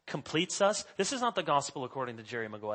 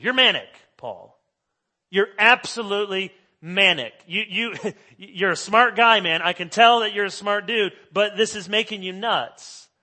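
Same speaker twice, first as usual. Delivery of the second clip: mocking Festus; 5 semitones higher, 11 dB louder than usual.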